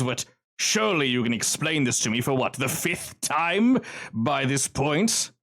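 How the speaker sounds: lightly voiced smug villain, unhurried; Kincaid's menacing drawl. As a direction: scold them slowly like a bored mob boss.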